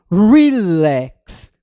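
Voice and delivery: relax very slow and loud, the vowels stretched; relax drawn out. Shown as a demonstration. Teacher showing students how to count syllables, relax drawn out. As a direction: stretch relax out as one mouth opening. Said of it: "Nós abrimos a boca pra falar apenas uma vez".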